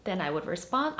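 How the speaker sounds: ordinary talk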